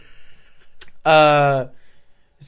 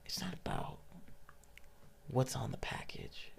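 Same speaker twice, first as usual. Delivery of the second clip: whispered, soft mentor voice; hushed wise teacher; 4 semitones lower, 15 dB quieter than usual. Retold or imitated. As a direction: hush it like sharing a secret. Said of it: "he goes".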